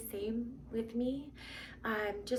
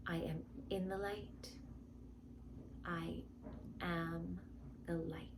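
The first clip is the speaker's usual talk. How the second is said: guided meditation; 3 semitones lower, 8 dB quieter than usual. Acted. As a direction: guide them gently into calm.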